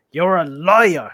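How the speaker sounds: loud, indignant troll voice; quarrelling troll. Acted. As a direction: hurl the insult, drawn out and loud. William accuses Bert in quarrel.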